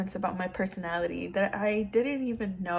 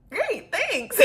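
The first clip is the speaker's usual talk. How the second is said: high-pitched, giggly thank-you; giddy thanks; 7 dB louder than usual. Retold or imitated. Replying to a compliment at work, giddy. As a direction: squeak out thanks and break into a laugh.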